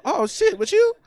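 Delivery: rising high squeal of disbelief; mock disbelief, high and rising; written reply read high. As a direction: read the note in a high, climbing voice. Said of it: "And I really wrote".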